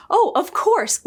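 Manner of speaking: high, hammed-up dawning oh; mock realization. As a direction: play the sudden penny-drop. Loial realizes the solution.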